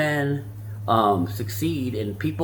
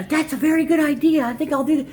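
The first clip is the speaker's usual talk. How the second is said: put-on high, girlish daughter voice, agreeable. The daughter agrees to her dad's idea in a high girlish voice. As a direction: play the daughter, sweetly agreeable. Daughter answering about whiteboard.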